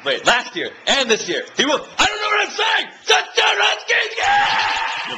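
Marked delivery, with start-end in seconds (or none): 1.6-5.2 very high, loud screaming; losing it over a touchdown; real fan yelling in joy; ecstatic scream. Real, not acted.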